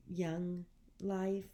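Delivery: ordinary talk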